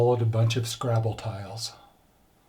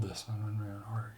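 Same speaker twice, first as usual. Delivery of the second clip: fast whispered aside; whispering; 13 dB quieter, much faster than usual. Real, not acted. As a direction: hush it quickly, under the breath. Whispered aside before the joke.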